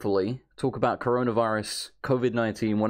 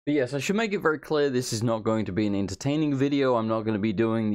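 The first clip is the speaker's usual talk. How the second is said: normal talking voice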